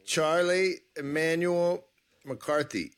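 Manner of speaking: slow, careful try at the guest's full name, in the wrong order. Real, not acted. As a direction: say the name slowly, testing it out. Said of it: "No, Emmanuel Charles McCarthy".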